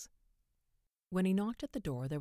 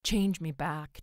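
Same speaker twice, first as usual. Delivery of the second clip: loud, urgent plea; pleading; 8 dB louder, slower than usual. A narrator's reading. Man begging the stranger to undo his wish, pleading. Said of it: "George pleaded".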